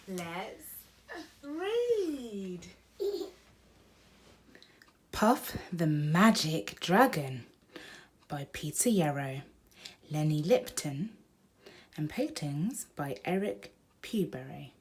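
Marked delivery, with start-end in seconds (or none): 5.1-14.7 put-on reading to children. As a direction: announce the book like a storybook start. Reads book title to child.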